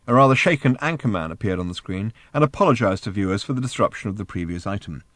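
ordinary delivery, nothing marked

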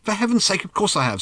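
high, impatient outburst; exasperated. A narrator's reading. Arthur answering Ford's suspicious question, exasperated. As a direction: swat the doubt away, exasperated.

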